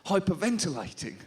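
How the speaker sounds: ordinary talk, nothing marked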